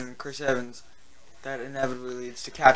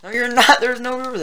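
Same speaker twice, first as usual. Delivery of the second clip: much higher, louder disbelief; incredulous; 9 semitones higher, 8 dB louder than usual. Real, not acted. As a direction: call out the obvious lie. Disputing friend's claim.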